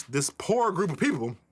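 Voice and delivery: ordinary delivery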